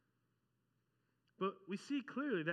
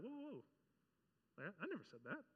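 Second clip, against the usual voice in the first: taken aback; 2 semitones higher, 9 dB quieter than usual. Retold or imitated. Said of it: "And you're like".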